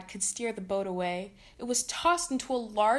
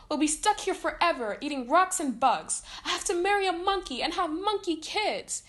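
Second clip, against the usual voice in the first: high, loud panicked wail; upset; 8 semitones higher, 4 dB louder than usual. Acted. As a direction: spiral into melodramatic panic. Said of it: "Candace was upset".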